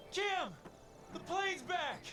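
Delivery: high shout across the yard; calling out. Acted. Husband calling his wife about the plane, joyful call. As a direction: call out to her with joy.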